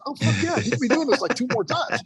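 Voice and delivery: high-pitched, gleeful retold reply; excited encouragement. Retold eager encouragement to student.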